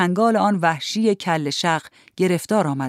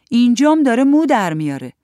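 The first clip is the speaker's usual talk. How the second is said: put-on high, loud boyish announcement; little boy; 6 semitones higher, 7 dB louder than usual. A narrator's reading. Boy showing off to older kids, loud boyish voice. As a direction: blurt it out like a young boy.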